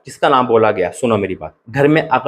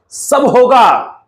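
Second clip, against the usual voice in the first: high, stretched-out and loud declaration; fervent proclamation; 12 semitones higher, 4 dB louder, much slower than usual. Real, not acted. Pastor assures viewers their hopes will come true, a soaring slow cry. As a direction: cry it out as a promise.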